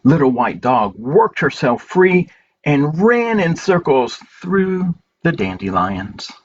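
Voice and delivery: gentle, unhurried picture-book reading with long pauses; reading to children. Acted. Reading A Good Day to children at story time, gentle and slow. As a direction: read it slowly to little ones, letting each page land.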